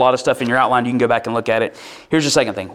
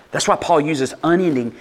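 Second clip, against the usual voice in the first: normal talking voice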